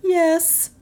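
high, small yes; high female character, dismayed; subdued shocked woman. A narrator's reading. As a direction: answer in a high-pitched stunned voice. Stunned woman answers detective.

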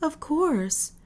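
high-pitched, lightly acted woman; high, airy female character; woman's voice. A narrator's reading. Louise answers in the dark.

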